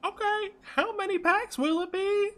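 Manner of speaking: slow, very high falsetto seller; drawn-out high-pitched sales voice; tears totally gone. Acted. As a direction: switch to businesslike high put-on voice. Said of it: "His tears are totally gone".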